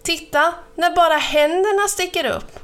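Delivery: high, eager show-off call; proud, high-pitched calling out; excited call. A narrator's reading. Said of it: "ropade hon".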